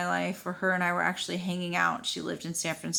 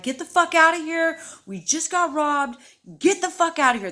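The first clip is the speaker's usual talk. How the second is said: loud, high retold yell; urgent warning yell; 9 semitones higher, 7 dB louder than usual. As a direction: shout a warning at oblivious passers-by. Warning passing couple, retold.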